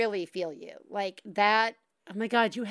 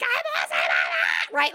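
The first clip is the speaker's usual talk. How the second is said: mock silent scream; 4 dB louder than usual. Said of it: "yelling, screaming, but silently".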